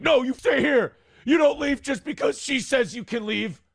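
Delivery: high, loud mock yell; heated retold demand. Nick telling his friend not to leave, high mock yelling. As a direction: imitate someone angrily ordering a friend to stay.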